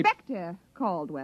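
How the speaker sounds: soft, low, cutting correction; curt correction. Acted. The inspector interrupting Brooks, curt and cold. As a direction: cut him off with the title. Correcting her title again.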